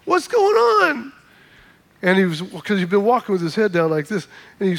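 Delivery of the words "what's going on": high, drawn-out call across the street; high slow hail from the car; mild retold yell. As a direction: call out to a friend on the sidewalk, stretched and high. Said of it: "I yelled".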